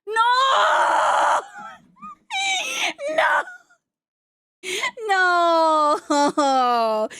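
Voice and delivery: long wailing cry of denial; mock despair. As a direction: wail it out, stretched and hammy. Mock despair at bad pun.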